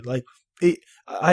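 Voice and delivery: ordinary talk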